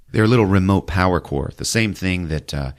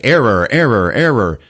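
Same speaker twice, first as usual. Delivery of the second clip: loud, higher machine-like error; robotic error readout; 4 semitones higher, 6 dB louder than usual. Retold or imitated. Computer screens flashing errors in a loud machine voice. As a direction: read it like a warning screen.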